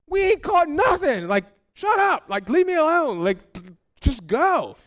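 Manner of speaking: high, irritated brush-off; mock annoyed fisherman; frustrated snap. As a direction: snap at the heckler to leave you alone. Frustrated disciples snapping back.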